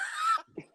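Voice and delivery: very high short laugh; real laugh. Real, not acted. Actor laughing at the Zero Dark Thirty joke, amused. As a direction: crack up briefly at a joke.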